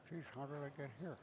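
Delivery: softly muttered mock player voice, under his breath; a light hint of the frustrated player grumbling to himself; imitating the player. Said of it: "You sound just like him".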